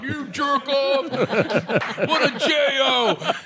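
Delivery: slow, laughing through the insult; can barely get the words out for laughing. Real, not acted. Telling it while laughing.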